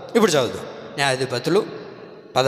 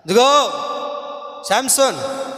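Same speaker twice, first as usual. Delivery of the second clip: alarmed call; 7 semitones higher, slower than usual. Acted. Delilah alarms Samson: enemies.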